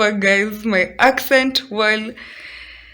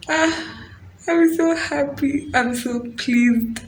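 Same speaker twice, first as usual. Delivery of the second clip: delighted; 6 semitones higher, slower than usual. Real, not acted. Delighted with finished wall.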